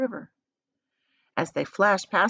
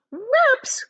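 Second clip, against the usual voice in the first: very high, loud shocked cry; Veruca cries; 5 dB louder than usual. A narrator's reading. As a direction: cry it in outrage. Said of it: "cried Veruca Salt".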